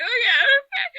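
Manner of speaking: high, teary okays with a laugh; half laughing, half sobbing; laugh-crying. Retold or imitated. Crying retelling, laugh-cry.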